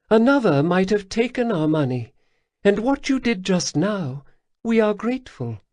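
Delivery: high, purring alien lilt; Khajiit matriarch. Acted. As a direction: speak as a grateful cat-woman elder. Khajiit leader Lesper speaks.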